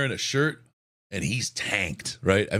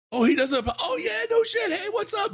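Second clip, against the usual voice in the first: excited friends. Retold or imitated. Friends reacting.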